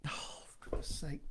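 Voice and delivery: very soft, mostly voiceless grumble; exasperated whisper. Real, not acted. Narrator annoyed at a fluffed line, real exasperated whisper. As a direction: whisper your frustration under your breath. Narrator frustrated by a mistake mid-recording.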